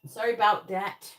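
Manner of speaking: put-on amateur Canadian accent, punched out loud. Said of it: "need to work on my Canadian accent".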